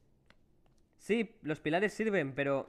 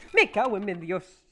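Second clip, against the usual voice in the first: loud, higher, slow curse; scared gamer swears at the screen; startled swearing shout; 5 semitones higher, 8 dB louder, slower than usual. Real, not acted. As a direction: yell it out in fright. Frightened by monster, swears.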